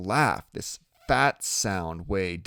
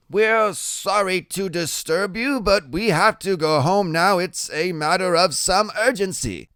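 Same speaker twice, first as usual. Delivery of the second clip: kid's high voice playing formal adult; stiff, grown-up politeness in a high, louder voice; trying to sound grown-up; 10 semitones higher, 5 dB louder than usual. A narrator's reading. Freak asking Iggy to go home, trying to sound grown-up. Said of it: "tries to make his voice sound deep and more grown up".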